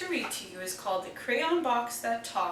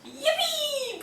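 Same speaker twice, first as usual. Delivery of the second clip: much higher, gleeful yippee; braying yippee; 14 semitones higher than usual. A narrator's reading. Goat about to eat everything, high braying cheer. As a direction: bray it out with glee. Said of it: "brayed Goat".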